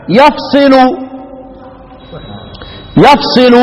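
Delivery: يفصل said "yafsilu"; يفصل as "yaf-si-lu"; Asim's light reading. Shown as a demonstration. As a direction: open with "ya", single s with i in يفصل. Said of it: "يفصل هو عاصم لوحده".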